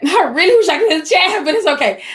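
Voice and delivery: a rueful laugh through the words. Real, not acted. Presenter laughs that she can't see the chat, laughing.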